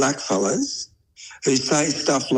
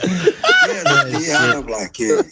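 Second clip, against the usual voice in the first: high, louder laughing reply; 4 dB louder than usual. Real, not acted. Uncle agreeing with his nephew, laughing high and loud. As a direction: agree while cracking up.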